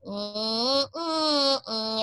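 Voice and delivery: thin "Ee" squeezed small; thinned out. Shown as a demonstration. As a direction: sing on a narrow noodle. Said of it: "singing on a noodle".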